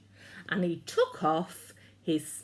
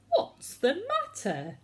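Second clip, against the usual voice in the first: kind giant asking; 12 semitones higher than usual. A narrator's reading. George asks the fox.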